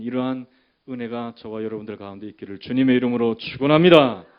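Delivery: loud benediction swelling to the end; preached blessing. Real, not acted. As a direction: build to a forceful closing blessing. Pronounces a blessing on congregation.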